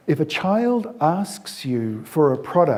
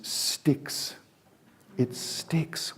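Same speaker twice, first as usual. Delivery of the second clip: whispered emphasis; 2 semitones higher, 7 dB quieter, much slower than usual. Real, not acted. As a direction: let it sink in, barely voiced. Drives the point home to listeners.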